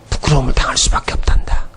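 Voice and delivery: low, near-whispered divine voice; whispered, fatherly admonition; hushed gentle warning. Acted. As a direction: drop to a soft whisper, acting as God. God speaking tenderly to Adam.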